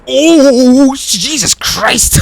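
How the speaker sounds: high loud startled yell; being chased. Real, not acted. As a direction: shriek in sudden fright. Being chased, narrow escape.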